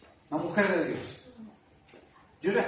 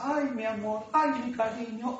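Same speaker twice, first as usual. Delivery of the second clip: imitating mom; 3 semitones higher, slower than usual. Imitating his mother's sweet greetings.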